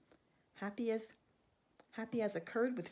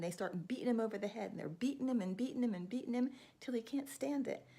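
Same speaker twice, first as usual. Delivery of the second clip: ordinary delivery, nothing marked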